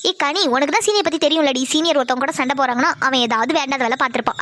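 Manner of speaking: put-on teasing girlfriend voice. Friend Pavi voiced lower. (தோழியை கேலி செய்யும் தாழ்ந்த குரல்)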